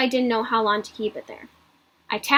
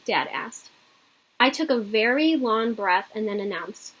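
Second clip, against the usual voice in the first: ordinary delivery, nothing marked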